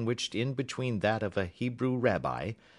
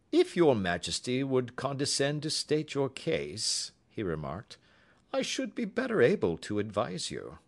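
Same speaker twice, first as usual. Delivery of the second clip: a lightly voiced, higher, crisp detective; Holmes, cool and courteous, raised in pitch; 7 semitones higher than usual. A narrator's reading. Holmes introducing Watson to the visitor, politely.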